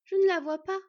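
high, loud cry of alarm; worried. A narrator's reading. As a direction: call out anxiously, searching for someone. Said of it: "s'inquiéta ensuite la renarde".

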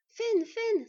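high, loud call of a name; excited cry. A narrator's reading. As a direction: cry out as you rush to a friend's bedside. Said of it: "s'écria Bord en se précipitant". (cri empressé, aigu et fort)

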